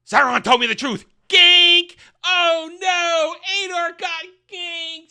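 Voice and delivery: loud, high hammed-up yell; mock-dramatic shouting. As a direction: shout it like an over-the-top melodrama. Mock-dramatic retelling of the gank.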